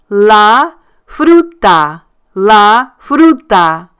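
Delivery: "la fruta" very slowly, leaning hard on FRU; stressed syllable. Shown as a demonstration. Teacher modelling Spanish pronunciation for non-speakers, slow stressed "FRU" in "fruta". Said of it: "one thing you do want to stress, the syllable".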